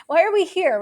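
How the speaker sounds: playing a baffled soldier who wants out; exasperated Russian soldier. Russian soldier asking why they're fighting, exasperated and loud. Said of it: "your response to that is".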